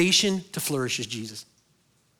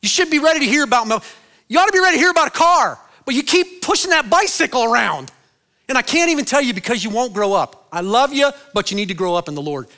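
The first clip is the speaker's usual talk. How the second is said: high, loud exasperated rebuke; angry scolding; 8 semitones higher, 7 dB louder than usual. Retold or imitated. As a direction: scold the church like a frustrated apostle. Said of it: "And he was angry at them".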